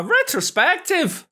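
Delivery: put-on light Scottish accent slipping in unplanned; a faint passing Scottish lilt. Vlogger announcing a look-back week, lightly Scottish accent. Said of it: "in a Scottish accent".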